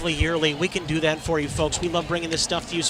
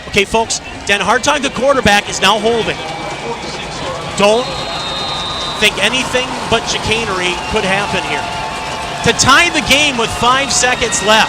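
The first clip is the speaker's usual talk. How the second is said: rising play-by-play, getting louder; building excitement; 5 semitones higher, 6 dB louder than usual. Real, not acted. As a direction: tension building on the call. Live game call building.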